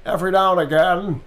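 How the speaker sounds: Cary Grant transatlantic with some Nick Cage spice; swooping transatlantic character voice; Heisenberg, transatlantic. Shown as a demonstration. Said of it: "he's got transatlantic accent".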